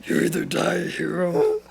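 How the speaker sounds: tired, breathy hero line, falling to a whispery end. Acted. Friend doing the villain line for a laugh, tired voice. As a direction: say it worn out, with lots of breath. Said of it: "He's really tired. He does a lot of breathing".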